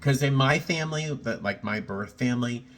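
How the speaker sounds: ordinary talk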